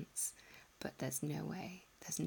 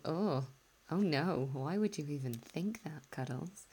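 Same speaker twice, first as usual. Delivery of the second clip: louder, hammed-up horrified protest; mock dismay; 5 dB louder than usual. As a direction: play an appalled listener objecting. Imagined listeners' appalled reaction.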